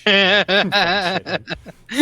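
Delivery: loud, slow, drawn-out sneer; mock sneer. Mocking the neighbor filming him, loud, drawn-out sneer. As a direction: stretch it out, sneering and laughing.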